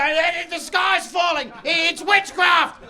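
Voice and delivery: high, drawn-out panicked shout; mock freak-out. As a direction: wail like doomsday has come. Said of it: "You'd freak out and go".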